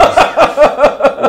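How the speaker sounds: real loud laugh; loud high laugh. Real, not acted. Laughs after explaining bollocks.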